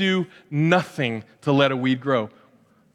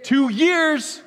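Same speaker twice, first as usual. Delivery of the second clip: high, amazed "two years"; surprised question; 10 semitones higher than usual. Real, not acted. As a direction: react with real surprise. Surprised at the answer.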